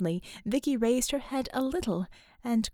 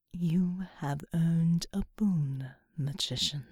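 low breathy whisper of a vast angel; whispering angelic being; 6 semitones lower, slower than usual. Acted. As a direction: whisper low and otherworldly, slowly. Seraphim speaks.

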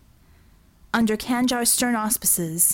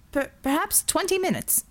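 nervous stammer; 8 semitones higher than usual. Acted. Nervous driver stammers an answer.

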